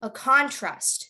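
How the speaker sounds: "contrast" said CON-trast; stress on first syllable. Shown as a demonstration. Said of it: "So if you were given a noun".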